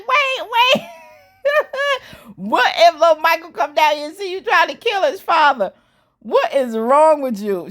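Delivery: high, laughing imitation of Fatima; laughing mock Fatima. Vlogger laughing as she imitates Fatima. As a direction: cackle while voicing her. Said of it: "she was like".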